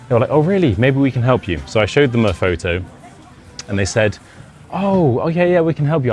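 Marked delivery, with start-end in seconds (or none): 0.3-1.6 high, keen "oh really"; eager surprise. Retold or imitated. Strangers eager to help. 4.7-6.1 much higher, keen "oh okay yeah"; eager offer. Retold or imitated. Strangers eagerly offering help.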